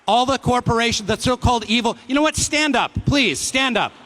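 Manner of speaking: loud, high-pitched urging; rallying the crowd. Real, not acted. Host urging corporate workers to stand up, loud rallying call. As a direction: call out insistently to the whole house.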